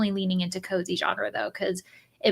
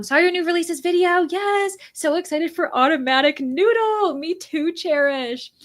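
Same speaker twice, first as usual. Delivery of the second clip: high, bubbly read-aloud of a viewer's comment; excited chat reading; 8 semitones higher, 3 dB louder than usual. Acted. Reading a viewer's comment to stream, high excited voice. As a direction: gush the comment out like a fan.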